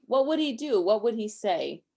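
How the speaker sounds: ordinary delivery, nothing marked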